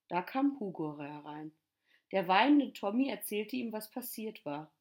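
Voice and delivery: plain reading voice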